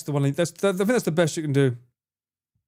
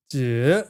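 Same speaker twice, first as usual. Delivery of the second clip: "du" sinking low, then curling back up; dipping tone; 4 semitones lower than usual. Shown as a demonstration. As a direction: dip "du" down before raising it. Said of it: "there's different pitches".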